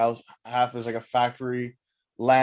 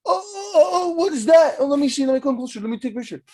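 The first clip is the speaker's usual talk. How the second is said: high-pitched silly gawker voice; exaggerated high cooing curiosity; mock curious onlooker; 13 semitones higher, 4 dB louder, faster than usual. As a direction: squeal like a clueless bystander. Mimics foolish curious onlooker.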